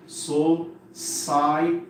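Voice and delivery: "society" very slowly in separate syllables. Shown as a demonstration. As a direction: break "society" into so-ci-e-ty with gaps. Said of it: "First divide the word into syllables".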